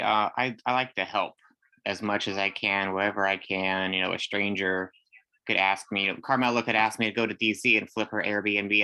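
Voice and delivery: ordinary talk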